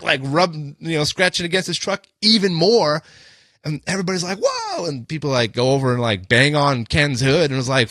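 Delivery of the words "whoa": very high imitated crowd gasp-cry; mock a startled bystander's whoa; high, drawn-out exclamation of alarm. Crowd reacting to the scrape.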